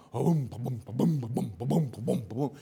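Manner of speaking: low rhythmic booms, like distant car speakers; imitated booming bass. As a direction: thump out a steady bass beat with the voice. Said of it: "you hear something".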